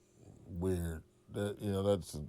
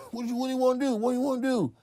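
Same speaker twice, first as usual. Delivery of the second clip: much higher, squeaky mock of a nervous rival; mocking Cat voice; 13 semitones higher, much faster than usual. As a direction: play the other guy as a jittery high squeak. Cat voiced, repeating challenge.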